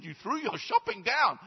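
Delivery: high, loud disbelieving question; amazed Peter. Acted. Peter amazed at the man's deed, high and loud. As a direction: ask it in amazement.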